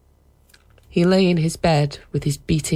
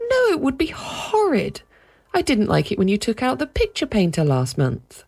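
put-on indignant child; 9 semitones higher than usual. Peter objects.

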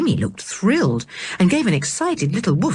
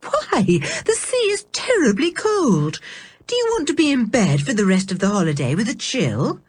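high, scolding aunt, fast at the end; worried aunt talking the children out of a swim; fussy aunt voice; 6 semitones higher than usual. A narrator's reading. Aunt fretting over a cold sea, high and quick.

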